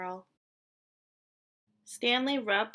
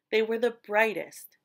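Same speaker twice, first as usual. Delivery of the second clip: ordinary delivery, nothing marked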